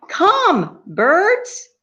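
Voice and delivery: high, sing-out summons; calling out to creation. Acted. God summons the birds, high sing-out call.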